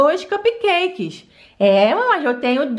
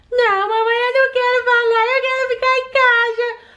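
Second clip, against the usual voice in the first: put-on higher, whining child who won't go to school; a pouty little girl begging to stay home; whiny little girl; 7 semitones higher than usual. Daughter whining to her mother about school, higher and pleading.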